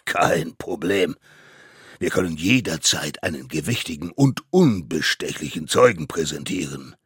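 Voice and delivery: loud, weighty courtroom announcement; pompous mammoth judge. Acted. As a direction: present your witness with great importance. (laut und gewichtig verkündender Richter)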